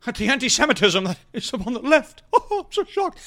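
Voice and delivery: mock-shocked anchor with a laugh; sarcastic fake outrage; lightly hammed newsman; mocking Jake Tapper. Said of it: "employs Jake Tapper".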